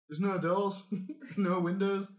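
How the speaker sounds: laughing northern barkeep; giggly Yorkshire publican; northern accent. Acted. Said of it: "That's how they talk".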